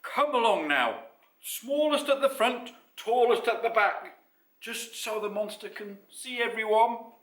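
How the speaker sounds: loud lion taking charge; Ralph the lion. A narrator's reading. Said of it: "said Ralph the lion".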